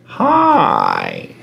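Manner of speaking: low, drawn-out parrot greeting; creepy parrot. Retold or imitated. Parrot sensing someone walking past at night, creepy drawl. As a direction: stretch the word eerily like a lurking bird. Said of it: "He's like".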